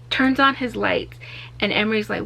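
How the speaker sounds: ordinary talk, nothing marked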